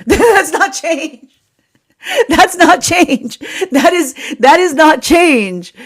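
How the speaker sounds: high, slow, laughing through "That's not change"; amused disbelief, rising into laughter; laughing insistence. Retold or imitated. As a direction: drag each "not change" out through giggles. Energy voiced, laughing insistence.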